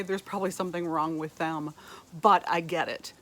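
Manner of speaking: normal talking voice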